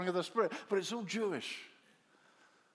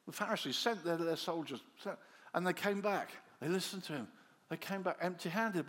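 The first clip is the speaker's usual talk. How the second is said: ordinary talk, nothing marked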